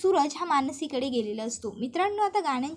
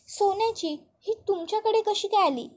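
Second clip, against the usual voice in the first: higher, alarmed asking; shocked question; 6 semitones higher than usual. Acted. Aunt recognises stolen gold ornament, shocked. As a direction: rise up in disbelief.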